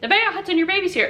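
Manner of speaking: high bright call, pitched well up; mother-in-law announcing the baby to the kids. Retold or imitated. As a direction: sing out to the kids that the baby came. Mother-in-law calling the kids again.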